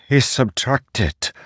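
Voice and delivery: a father choking on the word; grieving father, slow and strained; thick with emotion. A narrator's reading. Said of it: "his voice thick with emotion".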